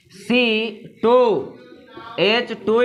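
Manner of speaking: C टू drawn out very slowly; slow dictation. Shown as a demonstration. Teacher dictating the formula to students, slowly. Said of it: "तो बोलेंगे".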